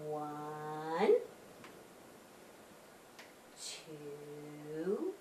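slow soft counting with long pauses. Shown as a demonstration. Host counting slowly, leaving room for children. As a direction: pause so kids can join in. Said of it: "slow counting for kids".

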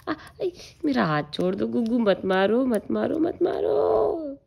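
high, playful mock cries to a toddler; mock pleading. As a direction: pretend to be hurt by the baby. Child hits her in play. (बच्चे से नकली गुहार)

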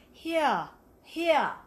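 HERE as a British "hee-ah"; careful British accent on HERE. Shown as a demonstration. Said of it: "I have to get the".

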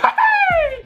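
sudden high-pitched laugh bursting out; high squeal of laughter. Real, not acted. Laughs after the roast.

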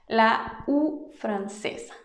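"U" said slowly with rounded lips, like i and u blended; French U. Shown as a demonstration. Teacher demonstrating French U slowly for Spanish speakers. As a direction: draw out the French "U" as a demo. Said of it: "no se pronuncia como la U española".

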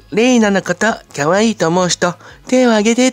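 high-pitched mimicked idol crowd call; rally the fans like an idol on stage. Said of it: "それに続いて[name]ちゃんも".

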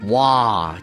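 plain reading voice

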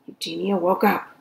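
ordinary delivery

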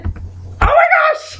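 very high-pitched outburst; exasperated exclamation. Real, not acted. Exasperated at wrong name answers.

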